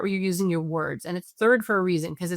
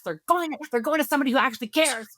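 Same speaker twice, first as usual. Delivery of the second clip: angry parent; 5 semitones higher, faster than usual. Retold or imitated. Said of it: "is with anger".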